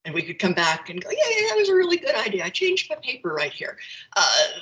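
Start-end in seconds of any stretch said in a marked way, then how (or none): 1.1-3.8 high, quick retold concession; imitating a chirpy change of heart, rattled off high; acted eager agreement. Acted reply on coming back.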